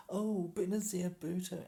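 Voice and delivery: high, loud, swooning "Oh, Benazir Bhutto."; gushing mock quote. Vlogger mimics people swooning over Benazir Bhutto, high and loud. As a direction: gush her name like a starstruck fan. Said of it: "And I think every time is".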